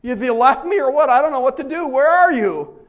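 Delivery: high, loud, lost plea to God; mock anguished prayer; desperate cry. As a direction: call out as if abandoned. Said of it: "sit down and say".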